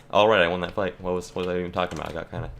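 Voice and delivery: normal talking voice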